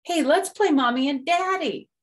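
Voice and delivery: put-on high, loud cooing girl; girl frog voice. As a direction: gush over the baby.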